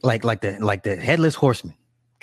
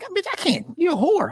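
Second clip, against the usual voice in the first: imitating him, much higher pitched, a hammed-up squeal; playing Gawain dumping his girlfriend, squeaky and much higher; mock squeaky outburst. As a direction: jump way up into a cartoonish voice to mock him. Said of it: "He's like".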